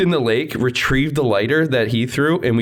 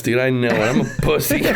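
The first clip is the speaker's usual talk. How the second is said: ordinary talk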